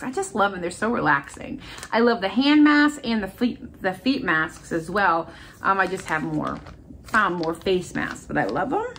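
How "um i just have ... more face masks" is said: ordinary talk, nothing marked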